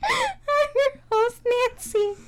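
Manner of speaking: high, slow showy host voice; podcast host voice. Pretend. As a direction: stretch it like a game show host. Mock host persona intro.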